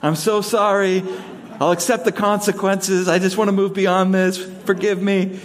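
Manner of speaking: high, pleading voice of a repentant dog; groveling puppy voice. Retold or imitated. Pastor voicing his guilty puppy to the owner, high and pleading. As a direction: beg forgiveness like a cowering puppy. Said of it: "Complete, full, utter submission".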